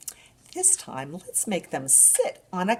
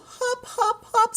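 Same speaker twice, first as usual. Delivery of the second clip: much higher, bouncy hops; 5 dB louder, slower than usual. Shown as a demonstration. Librarian showing a bunny hopping for babies. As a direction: make each hop spring up. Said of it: "What does a bunny do?".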